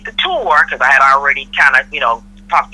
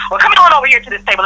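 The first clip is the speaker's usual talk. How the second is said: higher, fast, put-on sweet hostess tone; mock cheerful invite; 9 semitones higher, faster than usual. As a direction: brush it off with a breezy invitation. She retells inviting him over.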